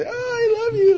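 very high, loud, gushing parody; hammed-up swooning declaration; mock movie lover. Movie lovers running through daisies, mock gushing. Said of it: "like you see in the movie".

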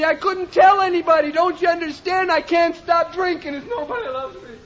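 normal talking voice

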